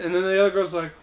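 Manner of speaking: ordinary delivery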